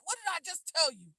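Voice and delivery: put-on a father scolding a child who ignored him; stern parent. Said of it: "You're just like".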